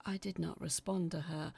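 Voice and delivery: usual speaking voice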